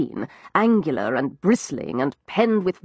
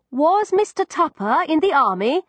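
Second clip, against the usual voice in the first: raised question to deaf woman; 8 semitones higher than usual. A narrator's reading. Narrator shouts to deaf woman.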